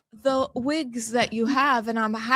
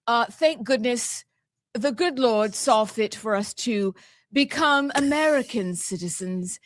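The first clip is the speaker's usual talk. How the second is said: usual speaking voice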